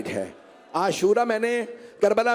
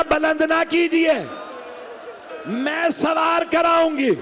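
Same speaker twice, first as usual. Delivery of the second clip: weeping plea; 8 semitones higher, slower than usual. Acted. Sister pleading with Hussain not to call out alone, tearful shout. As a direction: beg your brother through tears, drawing out each word. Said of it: "और रो रो के कहने लगी".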